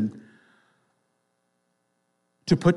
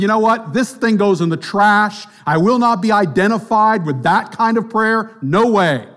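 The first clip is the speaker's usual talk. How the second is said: louder, higher, indignant refusal; mock indignant outburst; 7 semitones higher, 6 dB louder than usual. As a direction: play the self-righteous objector. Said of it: "I would have said".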